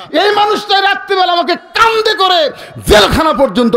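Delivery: very high, loud shouting; impassioned high-pitched preacher's shout; excited outcry. Acted. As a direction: shout the revelation to the whole crowd. Child excitedly identifies his helper.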